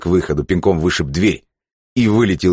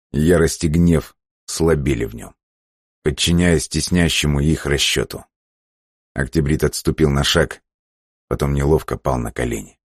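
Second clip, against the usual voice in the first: plain reading voice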